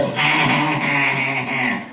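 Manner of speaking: mock cackle imitating the club owner; put-on staccato "ha ha ha" laugh; Mitzi. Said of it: "When she laughs, she goes".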